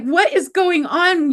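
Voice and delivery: imitating baffled friend. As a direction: stretch out the mock-exasperated question. Retold friend baffled by both.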